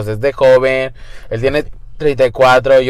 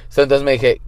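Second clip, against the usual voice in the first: ordinary talk, nothing marked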